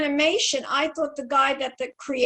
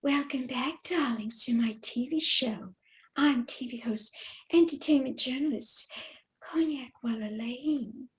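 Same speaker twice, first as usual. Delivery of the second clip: Marilyn Monroe; 6 dB quieter than usual. Shown as a demonstration. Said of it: "a Marilyn Monroe type voice".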